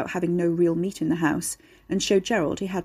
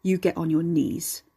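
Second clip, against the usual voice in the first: gruff low command. A narrator's reading. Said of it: "gruff and low".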